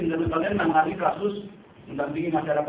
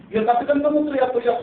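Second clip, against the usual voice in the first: police officer snapping much louder and higher; accusing shout; 10 semitones higher, 8 dB louder than usual. Retold or imitated. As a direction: bark the accusation at the activist. (polisi membentak aktivis dengan keras)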